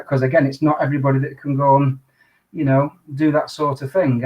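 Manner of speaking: normal talking voice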